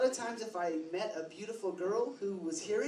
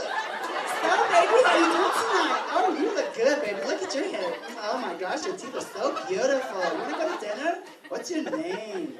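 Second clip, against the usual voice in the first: hammed-up, high and loud gushing flirt; 5 semitones higher, 7 dB louder than usual. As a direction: gush over a date, swooping high. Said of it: "friends who are men who love men".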